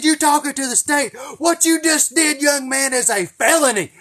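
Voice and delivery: put-on high, loud furious bark at a kid; screaming state trooper; angry trooper yell. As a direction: blow up at the boy like an outraged trooper. Trooper yelling after bribe.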